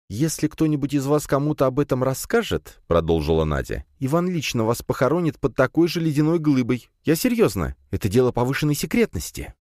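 high, mock-stern girl's threat; stern princess. A narrator's reading. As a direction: threaten the guards playfully. (строгая принцесса)

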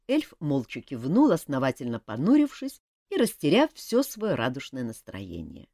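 ordinary delivery

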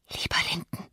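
very soft, tender whisper. A narrator's reading. Girl addressing her frightened friend, whispered. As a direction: whisper it gently, barely audible. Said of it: "flüsterte Catherine".

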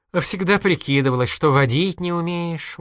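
lightly scolding; reproachful. A narrator's reading. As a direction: chide with a touch of hurt. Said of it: "укорил он".

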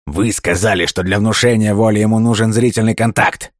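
loud, high, furious outcry; furious exclamation. A narrator's reading. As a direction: shout it in rage. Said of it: "В ярости воскликнул".

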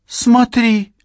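high loud exclamation; excited. A narrator's reading. Drugged Cheryl exclaims.

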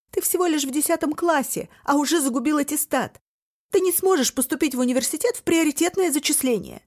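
high loud scolding; frantic reproach. A narrator's reading. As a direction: scold him in panic.

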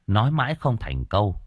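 usual speaking voice

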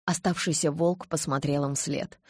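ordinary delivery, nothing marked

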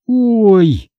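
high little cry; startled exclamation. A narrator's reading. Hannah hearing the gruesome deer story, exclaiming. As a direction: let out a high "oh". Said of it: "воскликнула Ханна".

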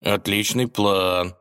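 slow, drawn-out approval. Acted. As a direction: stretch it out approvingly. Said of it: "одобрил царевич".

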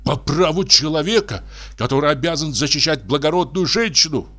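high, earnest remonstrance; doctor remonstrating. A narrator's reading. Doctor urging old investigator to come to senses, earnest. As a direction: talk sense into him.

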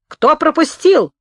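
high, loud cry of outrage; tearful shout. A narrator's reading. Gunman halting the carts, demanding who let them through, tearful shout. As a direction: shout it as a plaintive, drawn-out demand. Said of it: "закричал человек в фиолетовых галифе плачущим голосом".

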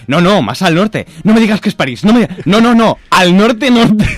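high, loud, frantic denial; panicked protest. Retold or imitated. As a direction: shriek it out in rising panic.

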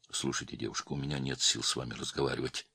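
low, soft, irritated reply; weary anger. A narrator's reading. Tired man fobbing off caller, quietly angry. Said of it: "зло ответил Стольников".

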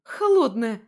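high, loud cry at cold water; girl crying out under a cold shower; shocked yelp. Acted. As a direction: yelp as icy water hits you. Cold water hits her.

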